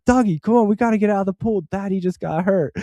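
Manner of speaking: put-on high-pitched voice of a mom hurrying a child; imitated urgent mother. Mother getting her boy out of the pool, high and urgent. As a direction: mimic a mother calling her kid out.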